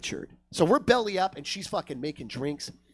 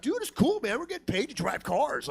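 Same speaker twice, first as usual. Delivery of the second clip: higher-pitched imitation of a laid-back dude; co-worker impression; 8 semitones higher than usual. Valet coworker reassures the comedian, higher imitated dude voice. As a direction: toss it off in a raised dude voice.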